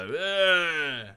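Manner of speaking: loud, high mock groan of disapproval. MPs in the chamber jeering a politician, with a loud groan. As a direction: groan like a jeering parliament bench. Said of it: "the members of the chamber will go".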